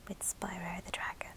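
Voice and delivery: usual speaking voice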